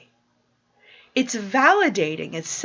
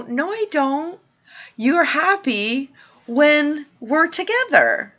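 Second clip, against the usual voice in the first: high, emphatic cheerful rebuttal; playful correction; 8 semitones higher, 5 dB louder than usual. Retold or imitated. Answering someone who says you make me happy, high bright correction. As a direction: toss the credit back, pitched up high. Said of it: "I go".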